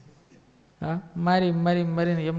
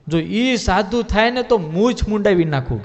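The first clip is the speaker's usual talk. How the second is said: louder, higher, slow boastful vow; proud courtier's loud slow boast; 4 semitones higher, 7 dB louder than usual. Acted. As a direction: swear it like a proud warrior staking his moustache. Sura Bapu boasting at the assembly.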